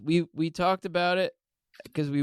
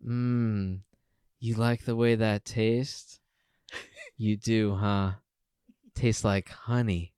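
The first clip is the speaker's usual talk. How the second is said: low, slow seductive murmur; sultry phone-sex voice; 6 semitones lower, slower than usual. Retold or imitated. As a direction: purr it like a dirty tape. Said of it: "she's just like".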